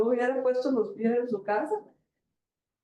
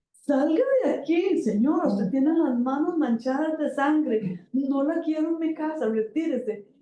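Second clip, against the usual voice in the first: indignant order to leave; 7 semitones higher than usual. Retold or imitated. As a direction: throw her out of the house with scorn. Judge throws her out of house.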